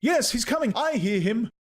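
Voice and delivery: high-pitched joining cry; excited outcry. A narrator's reading. Dancers hearing the greater being approach, excited high cries. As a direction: chime in with a high, excited call.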